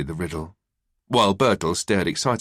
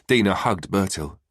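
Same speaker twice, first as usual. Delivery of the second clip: plain reading voice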